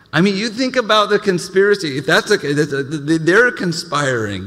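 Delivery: heated outburst. Real, not acted. Pastor railing to the church about conspirators, high and loud. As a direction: work yourself up against them. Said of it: "railing against conspiracy".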